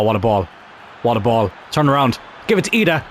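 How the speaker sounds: normal talking voice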